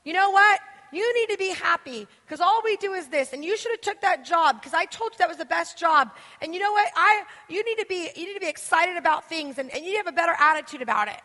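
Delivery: high, loud, bossy mother voice; a pushy mother yelling into your yard; nagging mom. Acted. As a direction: scold like an overbearing mom. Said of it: "Here's mom".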